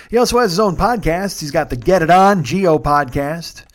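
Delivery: usual speaking voice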